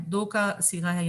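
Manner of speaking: ordinary talk, nothing marked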